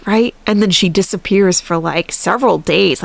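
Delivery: ordinary delivery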